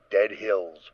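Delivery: ordinary talk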